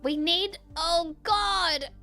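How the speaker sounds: ordinary talk, nothing marked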